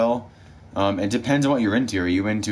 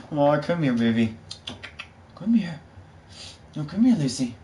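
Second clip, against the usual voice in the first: high, sweet coaxing voice to a pet; high pet talk; 7 semitones higher than usual. Real, not acted. To her dog Luce after poking its eye, high coaxing pet talk. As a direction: coax the dog over, high and gentle.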